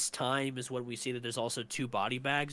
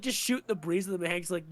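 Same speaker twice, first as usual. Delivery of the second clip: ordinary talk, nothing marked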